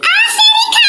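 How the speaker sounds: strained high reply. Pretend. Kid answers during pushing.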